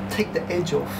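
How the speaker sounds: normal talking voice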